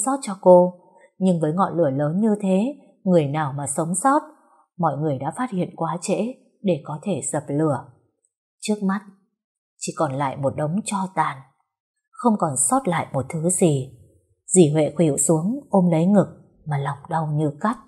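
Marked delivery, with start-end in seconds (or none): none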